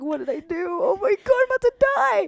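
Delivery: high, squealed mock terror; hammed-up high-pitched dread; retold panic. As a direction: wail it like you're doomed, playing it up. Retelling her inner panic at front seat.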